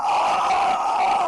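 long, loud harsh "aah"; drawn-out freak-out yell; bugging-out scream. Acted. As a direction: scream in dismay over a scratched car. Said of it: "Now he starts bugging out".

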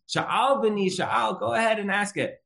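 high, drawn-out singsong invitation; imitating patient Hillel. Hillel telling the man to go ahead, high and singsong.

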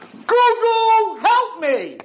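high, loud frantic cry for help; desperate scream. Retold or imitated. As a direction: shriek at the idol to save you. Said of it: "started to scream the name".

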